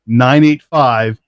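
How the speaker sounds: slow, deliberate digits; slow phone number. Shown as a demonstration. Host reading the call-in number, slow and clear. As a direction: read each digit out carefully.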